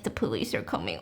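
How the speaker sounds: low, taunting mimic; mock her words in a dopey low voice; sneering imitation; mocking mimicry. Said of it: "giggling, like".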